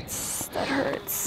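hushed wince. Real, not acted. Wincing at cow's wound.

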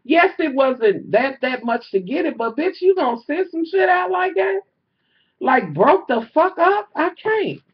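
high, loud, incredulous rant; retold exasperated outburst. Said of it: "I'm like".

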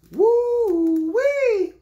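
high, loud woo-wee of delight; excited whoop. Real, not acted. Showing viewers the crisp sneakers, high delighted whoop. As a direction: let out a thrilled holler. Shoe reveal.

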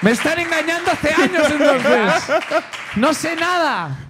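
mock outrage. Real, not acted. Fooled guest protests.

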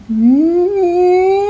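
put-on high, stubborn little-boy no; a kid digging in his heels; defiant child's no. Thomas refuses snowsuit.

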